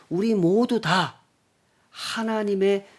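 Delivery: usual speaking voice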